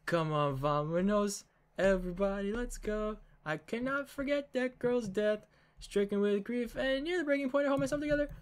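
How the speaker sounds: character voice. Acted. Another character's speech.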